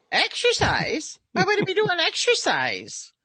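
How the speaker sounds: imitated Irish accent, drawn out and incredulous. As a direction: play an Irish forklift driver scoffing at exercise in a so-so Irish accent. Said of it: "I can't do an Irish accent very well".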